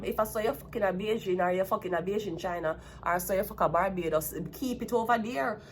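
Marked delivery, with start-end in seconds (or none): none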